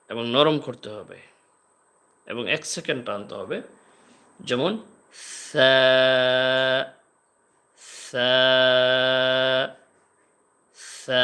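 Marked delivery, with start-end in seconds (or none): 5.5-11.2 light soft sa held one second; soft thin sa. Shown as a demonstration. Teacher showing students the soft Arabic sa. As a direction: breathe out a thin gentle sa. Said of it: "পাতলা করতে হবে".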